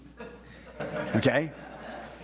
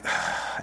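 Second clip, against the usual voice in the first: grumbling ugh. Retold or imitated. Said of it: "And there's that grumble".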